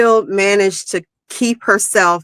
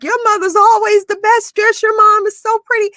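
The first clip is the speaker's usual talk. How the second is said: put-on high, gushing kid voice full of admiration; imitating schoolkids. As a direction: play the kids raving about her mom, way up high. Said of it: "all them kids would be like".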